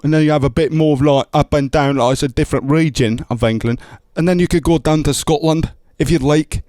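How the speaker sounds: tour of British accents; British and Scottish accents. Shown as a demonstration. Comic showing the host English then Scottish accents. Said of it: "you have an English accent".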